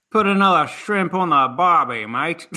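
hammed-up Aussie accent; Australian accent. Shown as a demonstration. Co-host answering the call for accents, mock Australian accent. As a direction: have a go at a jokey Australian accent. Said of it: "that was my Australian accent".

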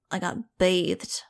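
slow, sulky grumble; grumbled. Acted. Freshly bathed dog grumbling to Max, slow grudging grumble. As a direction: drag the words out in a grudging grumble. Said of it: "he grumbled".

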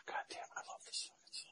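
a breathless whisper of love for the song; hushed, voiceless awe. Retold or imitated. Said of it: "I was like".

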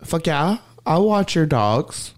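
high, gushing mock aunt; lightly mocking high voice of the aunt; aunt's eager voice. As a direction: mimic her overeager yes. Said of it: "she goes".

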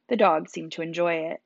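ordinary delivery

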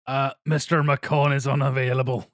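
low mock Irish accent, posing as French; slightly hammed-up Irish movie tough guy; Liam Neeson's Irish accent. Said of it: "undermined by his Irish accent".

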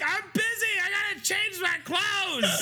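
high shrieking impression of a rushed wife; mock frantic yell. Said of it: "And you were like".

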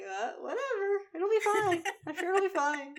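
high, breezy, dismissive shrug; Californian shrug. Retold or imitated. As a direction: brush it off lightly. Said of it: "we're just like".

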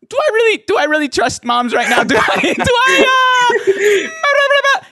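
high, loud hammed-up hesitant guest; mock a guest's hesitation. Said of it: "the hesitation in their voice".

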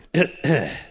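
a short, dry clearing of the throat. Acted. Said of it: "He coughs thoughtfully, dryly".

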